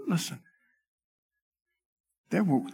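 ordinary delivery

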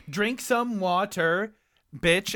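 "Drink some water, bitch" said slowly, syllable by syllable; counting out syllables. Shown as a demonstration.

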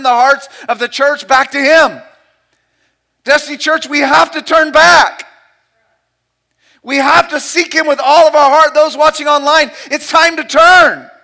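loud, rising sermon delivery; full-force preaching. Acted. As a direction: preach it out with mounting passion.

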